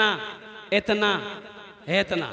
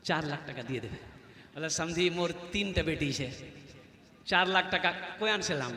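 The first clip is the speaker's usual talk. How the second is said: low, soft voice of a burdened father; subdued pleading; 7 semitones lower, 11 dB quieter than usual. Retold or imitated. Bride's father answering the dowry demand, quietly pleading.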